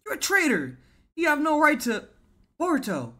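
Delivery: high, loud acted outrage; indignant character outburst; angry accusation.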